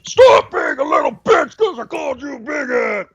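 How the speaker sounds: slow, high, shouted threat; tough-guy shout. Shown as a demonstration. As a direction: bellow it to sound intimidating. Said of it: "is that a little better".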